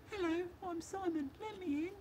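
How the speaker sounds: tiny, high, hushed voice; squeaky-small, soft pretend whisper; whispering key. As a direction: play a little key calling out very quietly. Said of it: "it whispers".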